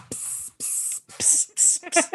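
whispered psst calls; hissed psst. Retold or imitated. Imitating the guy picking up girls, psst whisper. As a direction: call a girl with a sly psst. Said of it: "the guy".

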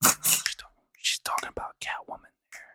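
hushed whispered aside; whispering. Real, not acted. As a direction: whisper it under your breath. Aside after acted scene.

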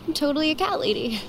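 soft, fast rueful mumble; self-mocking sigh. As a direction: sigh and admit it to yourself quickly. Self-mocking aside after sigh.

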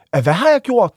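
high, loud incredulous question; re-enacted bewilderment. Defendant asking the court about the charge, high loud bewilderment. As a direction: ask it in acted disbelief.

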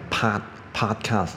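"pod-，podcast" with a wide-open, overdone "ah"; exaggerated American pronunciation. Shown as a demonstration. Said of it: "这个有一点夸张". (夸张的美式发音)

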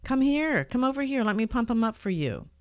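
high, loud insistent call; neighbor's loud call. Retold or imitated. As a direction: insist he come over, calling out. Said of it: "He said".

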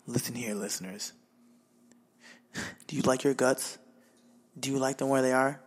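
low, flat radio promo voice; deadpan DJ promo read; depressed morning DJ. Acted. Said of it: "You sound like a depressed morning D J".

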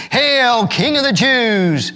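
high, slow mock salute; lightly voiced sneering soldiers; mocking hail. A narrator's reading. Soldiers mocking Jesus in the robe, slow mock hail.

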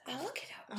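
quick whisper under the conversation; whispered aside. Real, not acted. Podcaster offers to check a fact, whispered aside. Aside while others keep talking.

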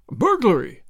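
loud startled cry. A narrator's reading. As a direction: blurt it out, shocked. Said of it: "cried the colonel".

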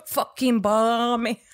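put-on slow, clumsy British accent; hammed-up British imitation; attempted British accent. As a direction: drag out the words in fake British. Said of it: "I sound like an idiot".

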